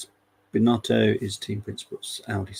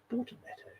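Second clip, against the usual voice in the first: "Bortoletto." breathed out in a much higher, mock-posh whisper; posh cheese name; 14 dB quieter, faster than usual. Shown as a demonstration. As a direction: savour "Bortoletto." like a fancy cheese, very high and airy. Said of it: "Sounds like a posh cheese".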